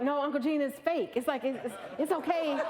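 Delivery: acting out anxious soothing; urgent reassurance. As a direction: stammer out a mock-flustered explanation. Retells calming her panicked uncle.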